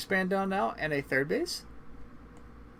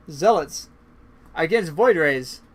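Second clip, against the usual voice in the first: announcer hype; 6 dB louder, slower than usual. Acted. Announcing the base race matchup.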